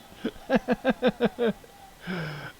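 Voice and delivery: high, loud staccato cackle; helpless high laughter; real belly laugh. Real, not acted. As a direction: crack up at your own joke. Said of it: "don't know why I'm laughing".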